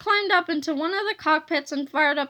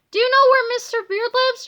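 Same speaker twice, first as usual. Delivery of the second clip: eager; 7 semitones higher, 5 dB louder, faster than usual. Acted. Eager question.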